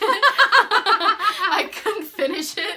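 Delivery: high, loud, slow laughing. Real, not acted. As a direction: laugh it out at yourself. Laughing at herself.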